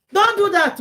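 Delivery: sudden high, loud outburst; emphatic warning. Real, not acted. Host telling advisers to stop, loud and emphatic. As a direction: cry out to stop them.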